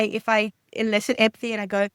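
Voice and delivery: usual speaking voice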